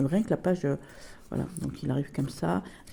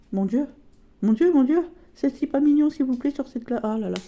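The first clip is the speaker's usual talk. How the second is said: much higher and louder, swooning; gushing excitement; 8 semitones higher, 4 dB louder than usual. Real, not acted. To viewers over a cute card, high excited gushing. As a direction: squeal over how cute it is.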